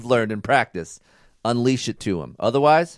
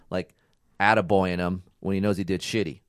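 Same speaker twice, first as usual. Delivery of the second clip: ordinary talk, nothing marked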